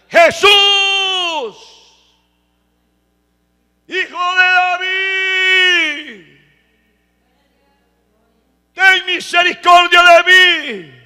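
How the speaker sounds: high, drawn-out, full-voiced acted cry; shouting harder. Blind Bartimeo persisting in calling to Jesus, shouting harder. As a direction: stretch each plea into a huge desperate shout. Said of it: "Gritó más fuerte".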